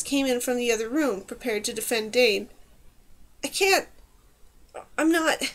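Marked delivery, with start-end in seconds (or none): 3.4-5.4 flustered stammer. Acted. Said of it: "Dane stammered".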